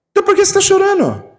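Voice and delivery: high, fast scornful jab; mocking scold. Man at the wake jabs at the mourner, high and fast. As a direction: throw the question at him with scorn. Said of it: "espírita de porco e fala assim".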